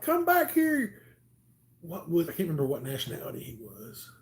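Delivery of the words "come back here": high, slow call after him; chasing yell. Retold or imitated. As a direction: shout after the runaway. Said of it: "we're like".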